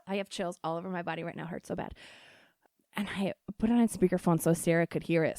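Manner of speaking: normal talking voice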